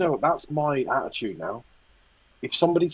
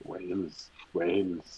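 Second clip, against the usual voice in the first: put-on soft, low Welsh-accent echo; hushed joke Welsh accent; mock Welsh accent; 6 semitones lower, 12 dB quieter, slower than usual. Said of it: "you started doing accents".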